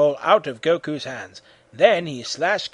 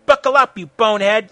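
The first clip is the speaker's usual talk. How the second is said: loud, high battle taunt; scream; 5 semitones higher, 8 dB louder than usual. A narrator's reading. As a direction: scream it at the enemy. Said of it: "screamed Goku".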